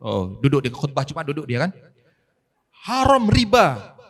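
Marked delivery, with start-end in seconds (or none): 2.9-4.0 high, loud, very slow pulpit voice; mock sermon proclamation. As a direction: boom it out like a preacher. Ustaz berkhutbah menirukan ceramah. (meniru khotbah keras dan lambat)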